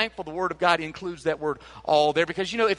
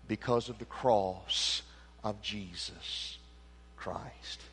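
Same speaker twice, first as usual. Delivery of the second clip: hushed awe; 8 semitones lower, 11 dB quieter, slower than usual. Acted. Sermon drops to hush.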